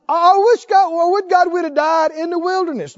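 high, loud mock wail of the grumblers; whining complainers. Preacher mocks Israel's grumbling in a high, loud wail. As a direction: ham up the people wishing they'd died.

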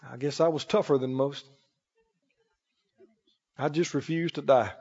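low, quiet mock modesty; soft, low boast, thrown away; self-crediting worldly person. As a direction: act out understated self-congratulation. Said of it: "ungodly world, they don't talk like that".

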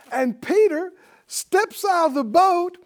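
high, slow proclamation; dramatic preaching. Real, not acted. Preacher's dramatic climax of story.

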